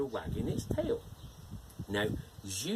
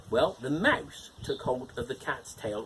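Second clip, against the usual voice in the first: ordinary delivery, nothing marked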